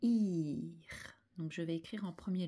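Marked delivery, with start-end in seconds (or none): 0.0-1.2 "Ir" with a long i first, then the r; sons détachés à l'oreille. Shown as a demonstration. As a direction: draw out the i of "Ir" before the French r. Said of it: "j'entends d'abord le i". (« Ir » étiré, i long puis r)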